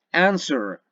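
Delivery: answer, high on AN, dropping on swer; stress on first syllable. Shown as a demonstration. Teacher repeating the example, answer stressed on first syllable. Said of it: "se encuentra en la primera sílaba".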